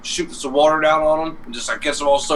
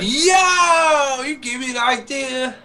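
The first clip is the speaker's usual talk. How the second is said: real excitement; 10 semitones higher, much slower than usual. Real, not acted. Worker seizing on a forklift prank idea, excited. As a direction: blurt it as the idea strikes.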